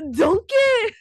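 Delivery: loud, high-pitched ogre-style call; Shrek. Pretend. Second try at Shrek for a friend, high and loud impression. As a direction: belt it out in a playful Shrek impression. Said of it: "say it like Shrek".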